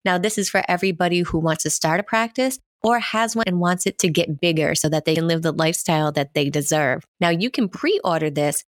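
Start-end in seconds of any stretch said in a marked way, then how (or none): none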